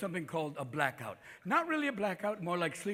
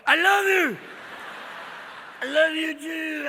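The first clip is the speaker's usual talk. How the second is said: high, loud hammed-up holler; drunken love cry; 9 semitones higher, 7 dB louder, slower than usual. Acted drunken lovemaking declarations.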